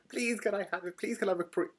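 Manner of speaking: high, urgent begging; acted-out excited plea; pleading. Acted begging message to partner.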